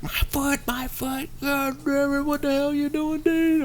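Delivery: very high-pitched mock wailing; imitated pained complaint. Hurt boss to Dean about his foot, mock pained anger. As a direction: wail and curse in a high put-on voice. Terry hurt and cursing, retold.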